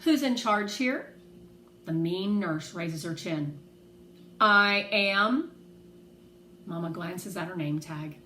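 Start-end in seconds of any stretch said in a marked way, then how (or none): 0.0-1.1 no-nonsense. A narrator's reading. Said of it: "puts on her best no-nonsense smile and asks". 4.4-5.5 louder, firm and clipped; stern nurse cutting Mama off; curt, stern reply. Acted. Mean nurse answers curtly.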